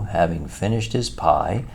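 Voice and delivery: ordinary delivery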